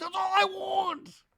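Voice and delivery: long, very high mock scream; imitated scream. Drunk Zach crashing out at Brittany, high drawn-out mock scream. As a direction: stretch it into a falsetto wail. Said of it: "He screams".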